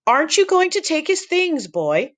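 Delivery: put-on very high sharp old lady; stern grandma voice. Grandma scolds Brandon.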